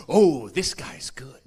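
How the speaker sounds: hushed, slow whisper of an impressed audience. Retold or imitated. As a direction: breathe it out, awed and slow.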